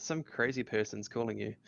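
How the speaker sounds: normal talking voice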